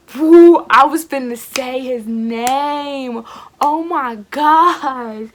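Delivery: loud, slow, caught-out exclamation; flustered surprise. Real, not acted. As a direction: she almost said the name and panics. Nearly lets slip a name.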